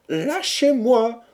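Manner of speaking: very high, slow, loud plea; pleading cry. A narrator's reading. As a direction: cry out to be let go, stretching the words. Captive pleads to be released. (cri suppliant, aigu et traînant)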